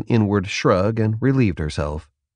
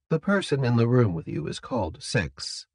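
soft, higher captor voice; composed captor; 4 semitones higher, 6 dB quieter than usual. Acted. Captor explaining a colleague's name to prisoner, soft and composed. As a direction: answer quietly and evenly as the captor.

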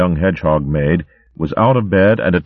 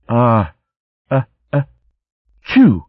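building sneeze, louder at the end; acted sneeze; 7 semitones higher, 3 dB louder than usual. Tansy tickled by a feather, sneezing loud. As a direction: wind up and sneeze. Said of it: "she sneezed".

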